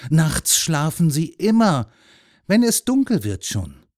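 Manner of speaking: loud, lively, emphatic voice; man reassuring a boy. A narrator's reading. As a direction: scoff at the teacher, then convince the child.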